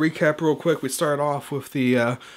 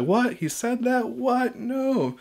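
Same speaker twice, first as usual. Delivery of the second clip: mock shocked disbelief; 11 semitones higher, much slower than usual. Mock tribemate reacting to accusation.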